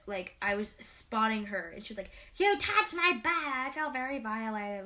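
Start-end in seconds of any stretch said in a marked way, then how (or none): 2.4-4.8 high, loud, hammed-up outraged whine; mocking dramatic teammate. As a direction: mimic her over-the-top outrage.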